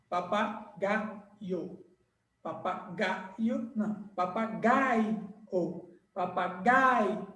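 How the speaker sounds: papagaio said slowly and softly; slow, stretched. Shown as a demonstration. Teacher modelling papagaio slowly. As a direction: draw out papagaio syllable by syllable. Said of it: "É assim que faz".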